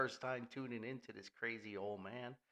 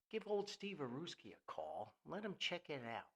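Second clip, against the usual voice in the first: ordinary talk, nothing marked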